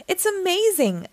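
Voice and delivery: high-pitched awestruck gush. A narrator's reading. Max gushes over the light show, high and excited. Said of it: "gushed Max".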